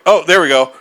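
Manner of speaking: loud, high, triumphant relief. Retold or imitated. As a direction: cheer it as the piece lands. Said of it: "I was like".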